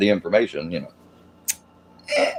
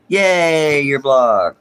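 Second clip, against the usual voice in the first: higher, sarcastic "yay" celebration; mock cheer; 4 semitones higher, 4 dB louder, much slower than usual. Streamer to a complaining viewer just blocked, mock cheer. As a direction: cheer it sarcastically, pitch up at the start.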